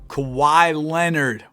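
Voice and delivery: ordinary talk, nothing marked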